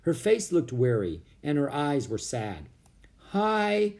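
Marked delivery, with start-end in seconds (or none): 3.3-3.9 high, held little greeting; shy. A narrator's reading. Annie meets the young woman Priscilla, shyly. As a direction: greet her a bit bashfully. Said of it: "said Annie shyly".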